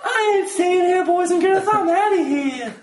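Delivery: high, slow mock outrage; fed up refusal. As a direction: storm out in a hammed-up, high voice. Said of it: "I'm like".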